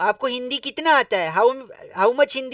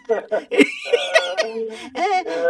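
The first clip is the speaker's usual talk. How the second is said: laughing with a hint of tears; teary cackle; wounded laugh; 5 semitones higher than usual. Real, not acted. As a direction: laugh that almost cries. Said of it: "जख्म वाली हंसी आ रही है".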